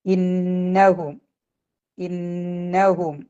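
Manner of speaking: ഇന്നഹും slow, double n held through the nose; recited ഇന്നഹും with ghunnah; Quranic recitation. Shown as a demonstration. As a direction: hum the nn of ഇന്നഹും. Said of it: "രണ്ട് count മണിക്കുക".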